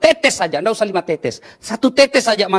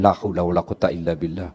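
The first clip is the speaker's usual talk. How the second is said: weary lament; 11 semitones lower, 6 dB quieter than usual. Acted. Exasperated sigh at nagging wives.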